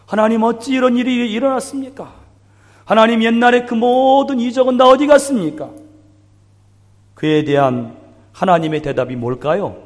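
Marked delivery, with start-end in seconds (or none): 0.1-5.7 pleading complaint. Acted. Preacher voices Gideon's complaint.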